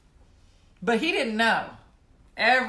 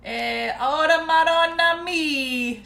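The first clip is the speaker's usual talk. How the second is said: high, slow Italian mom exclamation; 9 semitones higher, 9 dB louder, much slower than usual. Retold or imitated. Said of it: "She's like".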